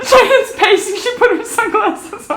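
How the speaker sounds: loud, high, giddy laughter in the words; laughing excitement. Real, not acted. Woman reporting on her pacing friend, loud laughing excitement. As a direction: shout it through giddy laughter.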